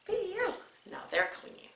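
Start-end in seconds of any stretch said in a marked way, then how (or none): 0.1-0.5 high-pitched playful pee-yew; mock disgust. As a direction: pretend to be put off by a stink. Pretends the socks smell bad.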